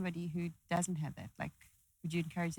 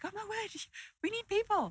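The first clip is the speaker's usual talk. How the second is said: high-pitched excitable extrovert voice; gushing extrovert. Acted. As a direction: pipe up high like a needy extrovert. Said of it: "it's more of an extroverted thing to say".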